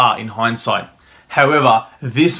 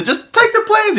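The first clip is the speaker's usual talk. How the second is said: very high, desperate falsetto; frantic high plea. Retold or imitated. Doomed soldier frantically pushes the plans through the door, pleading high. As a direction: thrust the words out in panic.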